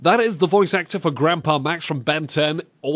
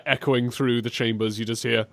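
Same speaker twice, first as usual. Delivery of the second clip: ordinary delivery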